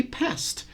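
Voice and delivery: past said slowly, ending in voiceless t; one slow syllable for past; same pronunciation. Shown as a demonstration. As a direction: past modeled exactly like passed. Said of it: "You say the same".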